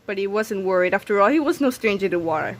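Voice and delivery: usual speaking voice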